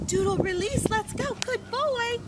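bright high-pitched dog praise; high happy praise, way up. Real, not acted. Trainer cheering her dog in a high voice.

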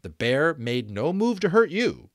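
usual speaking voice

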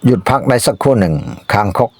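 plain reading voice

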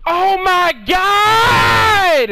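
high, loud, very slow "Oh my God!"; drawn-out outraged shout. Real, not acted. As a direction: stretch it out in exasperation. Outrage at game.

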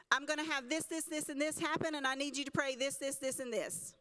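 loud, insistent mock list of demands; rattled-off laundry list. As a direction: reel off requests like a pushy list-maker. Acts people listing prayer requests.

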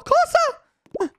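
very high drawn-out what; shrill stunned question; incredulous. Real, not acted. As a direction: squeal it in disbelief. Shocked by wizard zombie.

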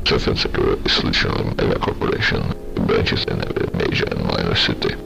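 ordinary delivery, nothing marked

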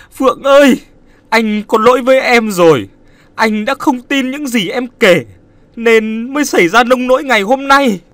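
high, loud anguished cry; a grieving man calling to his dead love; crying out in guilt. Acted. Nam crying out his guilt to dead Phượng, loud anguished wail. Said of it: "kêu lên những lời như thế".

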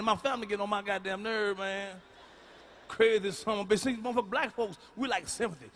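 ordinary talk

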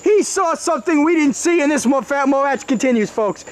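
high excited play-by-play; wrestling commentator. Acted. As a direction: call the near fall. Excited call of the count.

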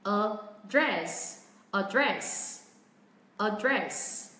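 "a dress" with a low first syllable and stressed, falling DRESS; stress on second syllable. Shown as a demonstration. Teacher models British "address" for learners, second-syllable stress on "dress". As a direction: keep "a" low, put the weight on "dress". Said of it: "hơi xuống giọng âm tiết thứ nhất".

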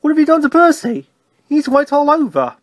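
startled questioning. A narrator's reading. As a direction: exclaim it high in surprise. Signalman startled at wet Percy.

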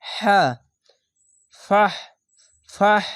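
ফাহ ending in an audible rush of breath. Shown as a demonstration. Teacher models the h for learners, strong breathed ending. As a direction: push breath into হা and the end of ফাহ. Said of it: "এই সাউন্ডটি প্রথমে বের করবেন".

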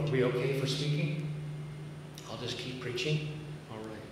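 normal talking voice

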